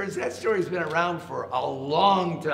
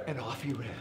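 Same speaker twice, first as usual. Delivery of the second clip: usual speaking voice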